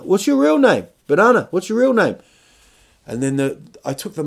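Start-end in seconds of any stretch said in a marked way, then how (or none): 0.0-2.2 high, loud, badgering demand; passive aggressive. Guy grilling the costumed banana, passive aggressive. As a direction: badger him in a raised, pitched-up voice. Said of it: "a bit aggressive, passive aggressive".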